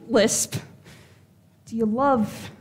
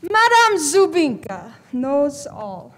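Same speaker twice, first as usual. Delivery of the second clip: slow, grand Eastern European mystic; fake Eastern European accent; slower than usual. Fortune teller boasting to a client, theatrical Eastern European accent. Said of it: "What happened to your accent?".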